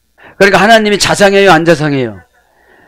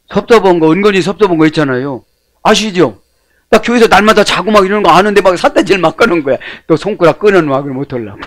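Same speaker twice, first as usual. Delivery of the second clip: usual speaking voice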